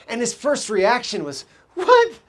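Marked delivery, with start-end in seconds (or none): 1.7-2.2 high, sharp startled what; shocked question. Retold or imitated. Freshman hearing his coach, startled high what. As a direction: react like you can't believe it.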